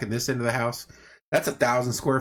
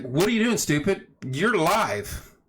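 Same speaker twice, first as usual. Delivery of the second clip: self-scolding; 6 semitones higher, slower than usual. Retold or imitated. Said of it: "my mind's like".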